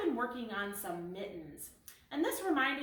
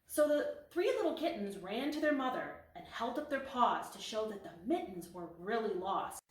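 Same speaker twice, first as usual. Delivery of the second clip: plain reading voice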